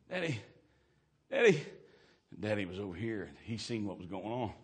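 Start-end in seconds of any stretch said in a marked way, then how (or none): 0.1-1.6 put-on scared child calling. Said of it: "she got scared".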